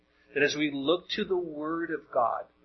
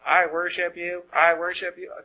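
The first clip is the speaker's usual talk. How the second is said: higher-pitched mechanical little robot voice; little toy robots; 4 semitones higher, 3 dB louder than usual. Acted. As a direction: chant it like programmed toys. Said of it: "Little robots all raising their hands".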